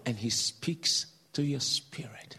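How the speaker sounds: slow, low near-whisper; hushed intimacy. Retold or imitated. Preacher explaining God's inner voice, in a hush. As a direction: breathe it slowly, like a secret.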